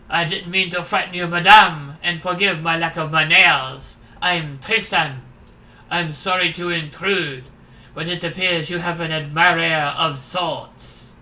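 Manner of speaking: hammed-up French accent, stretching the ends of phrases; a courtly Frenchman's accent, laid on thick. Vampire-like stranger apologizing for frightening a woman, French accent. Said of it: "we'll make him French".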